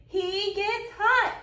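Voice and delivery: plain reading voice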